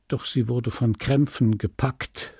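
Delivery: plain reading voice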